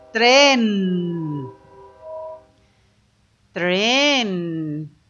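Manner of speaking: "tren" drawn out with a long humming n; blended sounds. Shown as a demonstration. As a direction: stretch "tren", holding the final n. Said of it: "Y si le ponemos la N".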